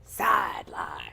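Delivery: a mildly possessed, sinister "Sideline"; spooky possessed "Sideline"; evil sounding. Real, not acted. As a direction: say "Sideline" like something evil took over. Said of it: "that kinda sounded a little evil".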